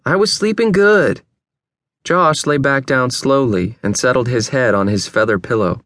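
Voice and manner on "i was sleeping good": high, put-out grumble; irritated sleeper's protest; aggrieved complaint. A narrator's reading. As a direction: complain about being woken, pitch raised. Woken friend complains.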